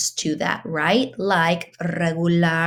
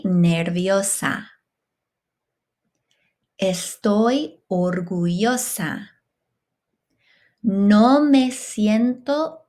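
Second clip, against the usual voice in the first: slow, carefully spaced phrases for students to repeat; slow model phrases; slower than usual. Shown as a demonstration. Teacher to class, slow clear model to repeat. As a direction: lay out each phrase slowly, one at a time.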